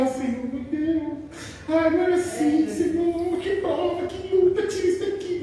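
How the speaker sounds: mock-pleading churchgoer begging God to take the trial away; whiny believer. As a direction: whine like a believer under trial. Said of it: "E nós ficamos".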